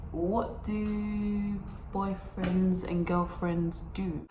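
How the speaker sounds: slow, soft, clueless question; mock naive question. Asking the boyfriend innocently, soft and clueless. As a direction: play dumb innocence. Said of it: "And I was just like".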